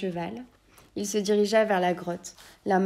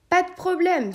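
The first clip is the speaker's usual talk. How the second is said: a bright, much higher elf voice calling back; eager elf, higher and louder; cheerful elf; 11 semitones higher, 6 dB louder than usual. Acted. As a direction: pipe it up like an eager little elf. The elf answers the old man.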